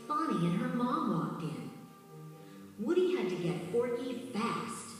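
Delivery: ordinary delivery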